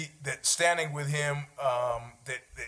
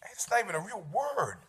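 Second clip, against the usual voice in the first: softer, dismissive mutter of "not even a real word"; muttered objection; 2 semitones higher, 8 dB quieter than usual. Pretend. Imagined listener doubting the made-up word, softly dismissive. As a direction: grumble it to yourself.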